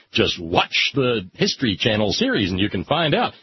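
usual speaking voice

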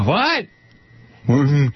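normal talking voice

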